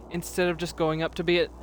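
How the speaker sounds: ordinary talk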